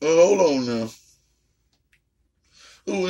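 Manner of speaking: ordinary talk, nothing marked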